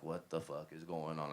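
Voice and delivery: low, soft, understated reported question; retold worried question. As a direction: quote her alarm quietly, without acting it up. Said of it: "so she's like".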